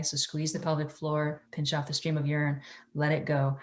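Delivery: soft, hushed meditation guide; airy breathy mystical healer. Acted.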